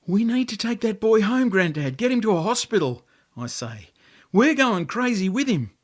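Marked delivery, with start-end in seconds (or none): 0.1-3.0 urgent plea. A narrator's reading. Boy pleading with Grandad. 4.3-5.7 urgent plea. A narrator's reading. Boy pleading with Grandad.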